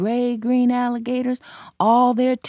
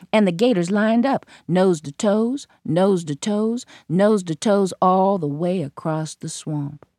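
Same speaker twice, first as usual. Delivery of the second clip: plain reading voice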